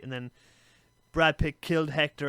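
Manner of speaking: usual speaking voice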